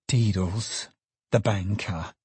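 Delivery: softened, mildly breathless shock; understated gasp; gasped news. A narrator's reading. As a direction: let the name out in a small gasp. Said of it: "gasped the secretary".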